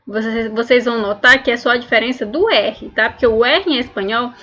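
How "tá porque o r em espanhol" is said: normal talking voice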